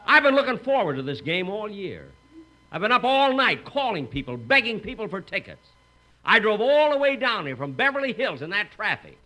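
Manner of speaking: indignant outburst. Acted. Jack to the ticket buyer, outraged and loud. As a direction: sputter with outrage at the lowball offer.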